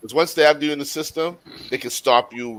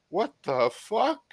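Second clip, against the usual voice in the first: slow, breathy stunned question; stunned disbelief; 7 semitones higher, 7 dB quieter, much slower than usual. Retold or imitated. Coming home to cops on the lawn, stunned. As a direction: react like you can't believe your eyes. Shocked seeing cops at his house.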